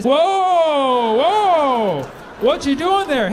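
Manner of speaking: loud panicked whoa whoa; alarmed shout. Pretend. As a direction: yell in alarm as the boat sinks. Other boater panicking.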